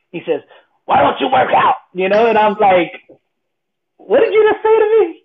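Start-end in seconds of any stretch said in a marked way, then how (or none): 0.9-1.8 higher-pitched, raised-voice challenge; challenging trainer. Retold or imitated. Trainer challenging speaker about working out, loud and pointed. 4.0-5.2 very high, loud mock outrage; indignant pride. Proud speaker reacting to trainer's challenge, indignant. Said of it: "I'm in my pride still".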